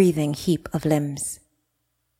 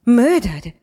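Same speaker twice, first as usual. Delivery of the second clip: higher, louder stunned echo; confused shock; 6 semitones higher, 4 dB louder than usual. Acted. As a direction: repeat the word in disbelief. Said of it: "shook her head in confusion".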